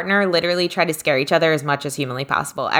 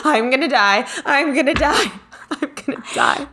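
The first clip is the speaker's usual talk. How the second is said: higher, fading to a laughing whisper; mock terror; 6 semitones higher than usual. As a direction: friend panicking in mock fear. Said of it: "You'd be like".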